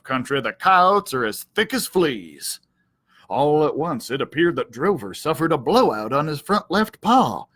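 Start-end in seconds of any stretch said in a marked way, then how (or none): none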